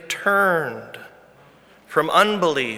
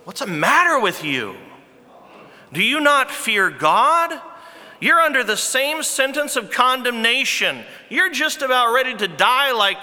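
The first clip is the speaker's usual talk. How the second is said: higher, stern rebuke; rebuking thief; 7 semitones higher than usual. Retold or imitated. Repentant thief rebukes the mocking criminal, sternly raised. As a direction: scold him for not fearing God. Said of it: "But the other rebuked him".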